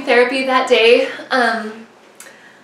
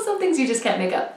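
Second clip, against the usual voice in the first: ordinary delivery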